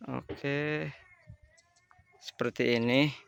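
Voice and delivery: ordinary talk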